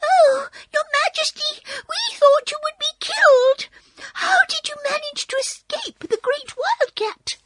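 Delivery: very high, excited mouse squeal; exclaiming mice. A narrator's reading. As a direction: squeal in alarm like tiny mice greeting their queen. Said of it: "they exclaimed".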